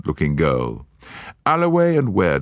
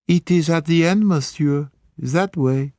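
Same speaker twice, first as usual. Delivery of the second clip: put-on higher, French-accented girl; French maid; 13 semitones higher than usual. French maid pointing Poirot to the room, French accent. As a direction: pert and helpful.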